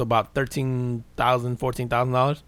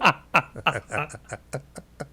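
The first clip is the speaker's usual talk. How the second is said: laughing; 3 semitones higher than usual. Real, not acted.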